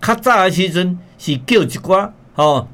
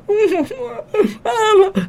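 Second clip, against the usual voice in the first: high-pitched mock sobbing wail; fake crying. Shown as a demonstration. Bride forced to cry before leaving home, fake crying. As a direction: put on a big, phony cry. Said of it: "爱假哭".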